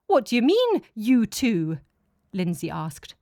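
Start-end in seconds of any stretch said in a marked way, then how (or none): 0.1-1.8 higher and louder, caught off guard; suddenly realising someone is left out; alarmed question. Acted. Lindsay alarmed at exclusion.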